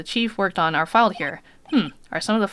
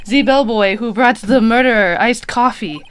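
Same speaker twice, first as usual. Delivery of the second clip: louder, proud French bellboy; French accent; 4 semitones higher, 8 dB louder than usual. Acted. Bellboy proudly boasting about the murderer, French accent. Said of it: "I'll give him French accent".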